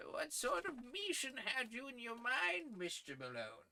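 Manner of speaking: put-on high, thin voice of a kindly old editor. Editor praising young Malone's articles, high old-man voice. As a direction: pitch him up as a fussy old newsman.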